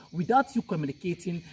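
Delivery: usual speaking voice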